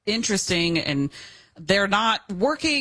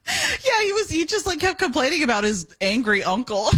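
laughing; 10 semitones higher than usual. Real, not acted. Laughing while recounting tour guide.

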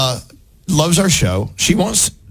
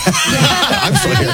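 laughing; much slower than usual. Real, not acted. Laughing at staying on the show.

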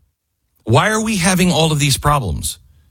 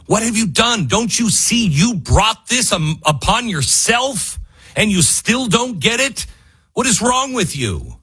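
high-pitched exasperated scolding; raised frustrated scolding; exasperated rant; 10 semitones higher than usual. Real, not acted. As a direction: berate the politicians in disbelief. Angry rant at Republicans.

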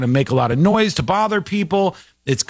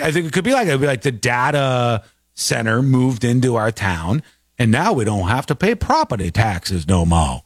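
usual speaking voice

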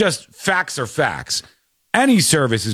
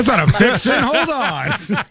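amused, laughing through it; laughing protest; 9 semitones higher, slower than usual. Real, not acted. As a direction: laugh as you object. Host laughs at clip answer.

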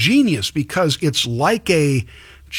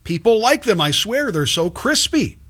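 higher-pitched, insistent mock protest; protesting commenters; 5 semitones higher than usual. Host voices commenters defending shoestring fries, mock protest. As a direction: plead the case like an indignant fan. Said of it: "people commenting, say".

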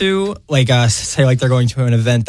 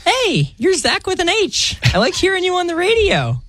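mock girl voice. Radio guest role-playing a woman greeting him, mock girl falsetto. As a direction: put on a squeaky-high girly falsetto. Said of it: "Gotta sound like a girl".

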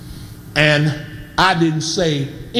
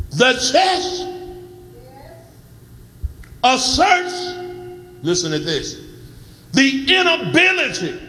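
raised, ringing preacher's cry over the organ; full-force preaching; 6 semitones higher than usual. Acted. Preaching climax with whoops, music.

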